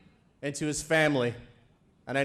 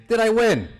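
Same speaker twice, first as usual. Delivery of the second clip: mock question; 6 semitones higher, 7 dB louder than usual. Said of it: "they have heard him say".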